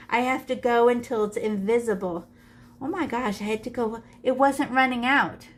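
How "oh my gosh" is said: usual speaking voice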